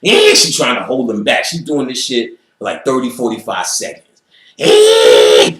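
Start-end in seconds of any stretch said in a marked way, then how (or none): 4.6-5.6 loud crying shout; wild cry. Retold or imitated. Ellis going crazy, a loud crying shout.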